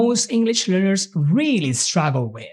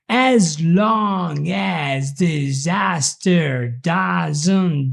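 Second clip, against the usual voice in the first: very slow, every sound spelled out; over-articulated; much slower than usual. Shown as a demonstration. Teacher drilling the learners in exaggerated articulation. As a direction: chew each syllable carefully. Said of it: "let's begin over-articulating everything".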